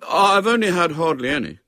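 put-on high, loud boyish plea; aggrieved schoolboy; indignant protest. A narrator's reading. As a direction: insist you've been hard done by. Boy protests he has eaten little.

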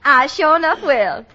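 put-on Southern accent. Secretary reads back the boss's letter, hammed-up Southern accent. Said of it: "a girl who talks like a Southerner".

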